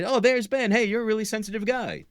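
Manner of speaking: a hint of a chummy passerby; mock friendly compliment. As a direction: toss off a cheery compliment, lightly played. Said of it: "if I".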